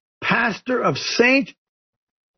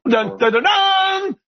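mock suspense sting; 11 semitones higher, slower than usual. Mock suspense sting before a list.

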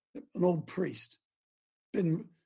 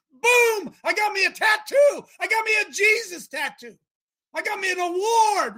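high, loud exultant crowing; excited boasting; 11 semitones higher, 7 dB louder than usual. Acted. Preacher acting out a rewarded believer, excited and high. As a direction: burst out like you just won a prize. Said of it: "you should be excited".

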